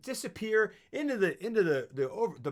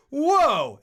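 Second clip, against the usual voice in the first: amazed whoa; 10 semitones higher, 11 dB louder than usual. Retold or imitated. Reacting to the film opening.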